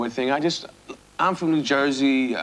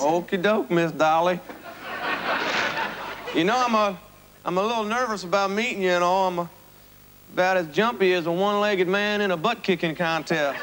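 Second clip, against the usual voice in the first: put-on cornball country accent; 4 semitones higher than usual. Nervous suitor rambling in a hokey country accent. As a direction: play the nervous bumpkin suitor. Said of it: "you're doing this cornball accent for".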